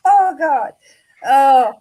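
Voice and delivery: crying with laughter; laughing through pain. Real, not acted. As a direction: wheeze out between laughs. Helpless laughter after the joke.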